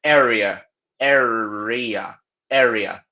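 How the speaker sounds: "area" with a curled, growled R in the middle. Shown as a demonstration. Teacher drilling students on the R in "area". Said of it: "a gente vai rosnar também".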